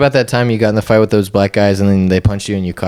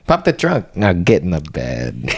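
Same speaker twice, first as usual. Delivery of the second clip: mock mechanic; 4 semitones lower, slower than usual. Friend mocking the mechanic's request, slow sleazy voice. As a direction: tease with a sleazy mechanic line.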